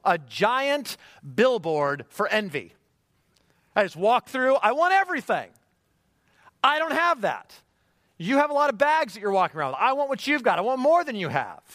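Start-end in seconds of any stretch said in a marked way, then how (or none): 3.8-11.6 high, loud, greedy craving; greedy wanting. Retold or imitated. Envious mall shopper eyeing others' bags, loud and high. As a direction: grab at everything you see in the mall.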